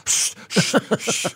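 urgent whispered shh; hissed panicky shushing; frantic shushing. Retold or imitated. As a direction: hush the crowd in a panic. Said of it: "panic-stricken teachers running".

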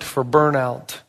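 usual speaking voice

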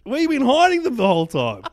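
high, loud, laughing plea; giddy demanding. Pretend. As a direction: beg for it like a giddy kid. Mock demanding cronuts, laughing.

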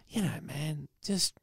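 soft, half-whispered plea to back away; hushed exasperation. Retold or imitated. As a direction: plead under your breath for room. Imagined plea to the crowding kid.

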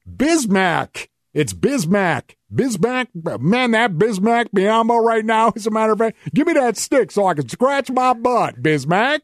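high-pitched send-up of sports announcers; exaggerated, high mimicry, hitting "Bismack"; mocking broadcasters. Host apes the pregame show Toms, mocking high-pitched voice. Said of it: "in the pregame and the postgame".

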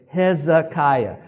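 "Hezekiah" slow and high, syllable by syllable; slow teaching pronunciation. Shown as a demonstration. Preacher has congregation say it along, "Hezekiah" in slow syllables. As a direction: spell out "Hezekiah" by sound for repeating. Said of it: "That's, again".

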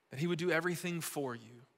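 ordinary delivery

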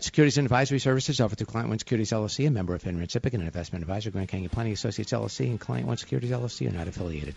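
rapid, soft disclaimer read; fast legal disclaimer. Acted. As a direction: rattle off the fine print quietly.

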